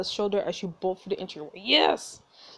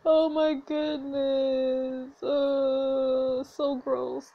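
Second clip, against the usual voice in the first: slow, drawn-out disgust; 5 semitones higher, much slower than usual. Real, not acted. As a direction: groan it in disgust. Disgusted reaction.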